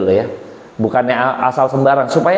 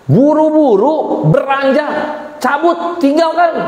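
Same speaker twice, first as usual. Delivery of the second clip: high-pitched, emphatic burst; urgent; 11 semitones higher, slower than usual. Acted. Preacher telling how Joseph fled temptation, urgent. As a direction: punch out each word high, as if fleeing.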